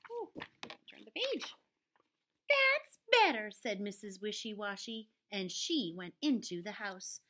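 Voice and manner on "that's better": high loud pleased voice; a brisk job-done sigh; pleased housewife; satisfied. A narrator's reading. Mrs Wishy Washy satisfied.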